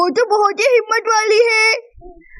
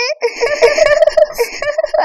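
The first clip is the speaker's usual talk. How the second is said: high laugh; 6 semitones higher than usual. Acted. Sister laughing at the mouse found in the machine. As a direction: burst of high-pitched laughter. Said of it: "सभी जोर जोर से हंसने लगते हैं".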